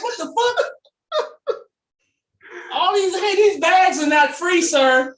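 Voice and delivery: very high, slow, laughing shout; laughing outraged yell. Retold or imitated. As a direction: cry out at him through laughter. Groceries spilled, scolding hero.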